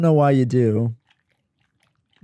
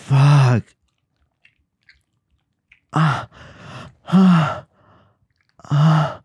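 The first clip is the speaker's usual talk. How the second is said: loud strained groans; 2 semitones higher, 5 dB louder, much slower than usual. Real, not acted.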